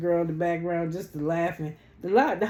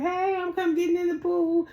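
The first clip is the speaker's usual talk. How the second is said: imitating Angela; 11 semitones higher than usual. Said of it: "She was like".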